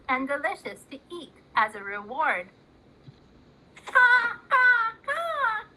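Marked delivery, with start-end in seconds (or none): 3.9-5.6 put-on high, loud babyish caws with a cry; baby cawing. Baby Joey copies the crow, high babyish caws. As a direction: wail the caws like a baby copying a bird. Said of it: "came little baby Joey".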